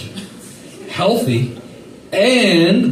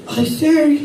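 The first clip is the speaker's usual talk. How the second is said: mock salesman pitch; 10 semitones higher than usual. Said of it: "And of course, like".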